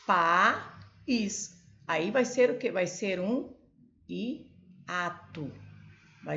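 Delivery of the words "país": País split into pa-ís, stress on ís; syllable split. Shown as a demonstration. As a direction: separate País into two syllables. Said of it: "separação de sílaba".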